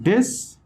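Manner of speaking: DIS punched high as the strong syllable; primary stress shown on a lone "dis". Shown as a demonstration. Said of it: "فشار اصلی است".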